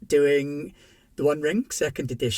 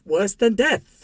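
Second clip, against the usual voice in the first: dramatic tease; 7 semitones higher, 6 dB louder, slower than usual. Real, not acted. Teasing the grim consequence.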